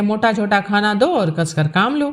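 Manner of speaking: usual speaking voice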